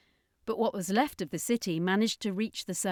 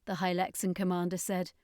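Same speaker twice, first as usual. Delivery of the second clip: ordinary delivery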